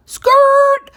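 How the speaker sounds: very high, loud tyre-screech noise; squealed record-scratch stop sound; mock screech to halt. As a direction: imitate brakes squealing to interrupt. Imitated screech to interrupt the recap.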